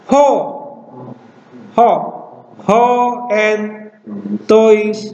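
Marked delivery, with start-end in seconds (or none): none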